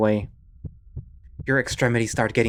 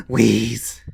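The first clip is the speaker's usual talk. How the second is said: wheezing breath; 4 dB louder than usual. Acted. Panic attack.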